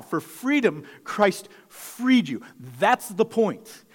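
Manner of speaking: preaching emphasis. Real, not acted. Preacher repeats the refrain to the church, loud and slow. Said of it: "You can live in that freedom now".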